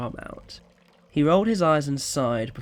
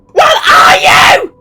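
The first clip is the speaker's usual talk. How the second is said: slow, crying scream, much higher and louder; 21 dB louder, much slower than usual. Acted. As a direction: shriek the demand. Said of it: "screamed Georgina".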